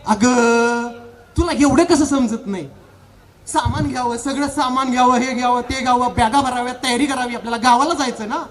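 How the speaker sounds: ordinary delivery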